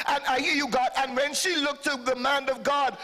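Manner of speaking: ordinary talk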